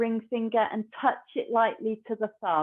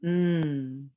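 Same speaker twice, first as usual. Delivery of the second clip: whispered hum; 4 semitones lower than usual. Shown as a demonstration. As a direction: let the breath hum out through the nose, mouth closed. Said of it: "like, this whispered".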